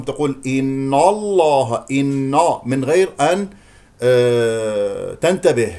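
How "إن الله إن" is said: إن الله with the N darkened to inno; wrongly heavy N. Shown as a demonstration. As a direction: thicken the نّ of إن before الله. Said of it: "النون ممكن أن تفخم". (إن الله بتفخيم النون خطأً)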